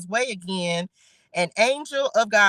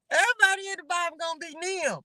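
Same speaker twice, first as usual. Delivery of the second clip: high, quick laughing joke; laughing quip; 9 semitones higher, faster than usual. Real, not acted. Host joking about the names, high and quick. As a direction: toss it off still giggling.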